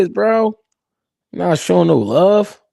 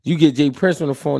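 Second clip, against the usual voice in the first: ordinary talk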